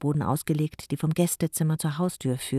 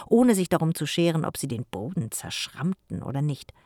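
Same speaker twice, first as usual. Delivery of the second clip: usual speaking voice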